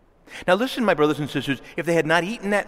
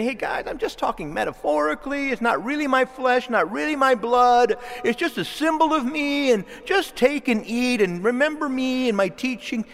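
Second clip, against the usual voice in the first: airy, higher voice brushing it off as metaphor; mock casual dismissal; 9 semitones higher than usual. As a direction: play it light and offhand, as if it's nothing. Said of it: "He doesn't say".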